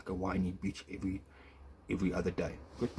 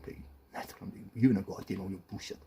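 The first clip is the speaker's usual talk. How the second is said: ordinary talk, nothing marked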